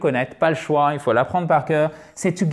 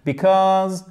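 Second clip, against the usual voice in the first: because with stress and long vowel on cause; stress on second syllable; 3 semitones higher, 4 dB louder than usual. Shown as a demonstration. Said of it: "voyelle longue en plus".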